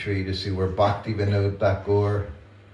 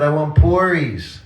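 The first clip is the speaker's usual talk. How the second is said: put-on high and loud childish demand; stubborn child; 6 semitones higher, 8 dB louder than usual. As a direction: insist like a small boy who won't give up. Child insisting, teller in ecstasy.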